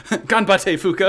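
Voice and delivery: lightly mock Japanese cheer, laughing; a hint of fake Japanese fisherman. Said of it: "the fake Japanese fisherman".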